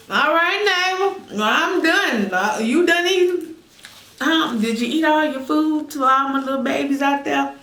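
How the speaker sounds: cooing to viewers. Real, not acted. Cooing to viewers as her babies.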